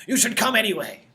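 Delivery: high, insistent villager voice; pleading customer. Acted. Customer presses Hector to come, high and insistent. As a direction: jump up in pitch, coaxing.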